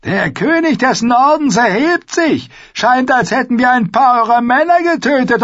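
high, loud mocking old man's voice; gloating old lord. A narrator's reading. Lord Walder mocks the fallen king, gloating giggle. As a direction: jeer with a cackle at the end. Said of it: "kicherte Lord Walder Rob ins Gesicht".

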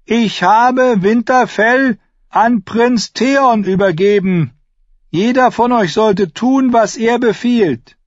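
put-on slow, very high, loud boyish proclamation; child lord calling out slowly and high. A narrator's reading. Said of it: "Er hob die Stimme".

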